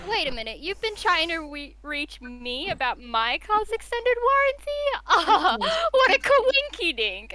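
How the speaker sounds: high, mock-amazed service voice; phone agent skit. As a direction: act delighted by the coincidence, pitched high. Plays along in warranty call skit.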